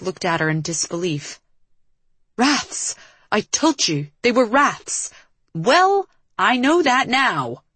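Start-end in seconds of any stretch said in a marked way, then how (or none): 2.4-5.1 higher-pitched, indignant exclamation; exasperated outburst. Acted. Acted quarrel, exclaimed reproach. 5.5-7.6 high, slow, exasperated yell; yelling back. A narrator's reading. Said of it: "Liv yelled back".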